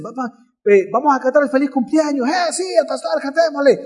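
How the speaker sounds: high, eager calls to start singing; excited crowd call. Retold or imitated. Congregation urging the pastor to sing, high and eager.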